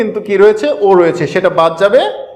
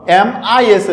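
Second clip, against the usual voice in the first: slow spelling; much slower than usual. Shown as a demonstration. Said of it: "ই double এস যুক্ত করেছি".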